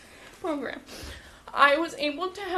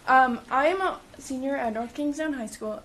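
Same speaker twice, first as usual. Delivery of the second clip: ordinary talk, nothing marked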